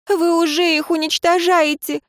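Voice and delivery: high tearful cry; sobbing. A narrator's reading. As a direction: cry the words out, near tears. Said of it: "всхлипнула Лейра Дорин".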